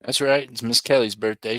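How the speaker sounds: ordinary talk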